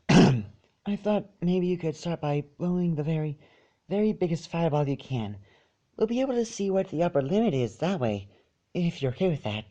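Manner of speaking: high, soft and meek; timid little pony voice. Acted. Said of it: "she finished meekly".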